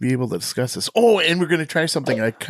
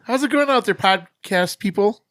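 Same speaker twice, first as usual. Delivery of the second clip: high, loud, stretched host call; hyped-up greeting; 10 semitones higher, 5 dB louder than usual. Acted. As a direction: shout out to the listeners.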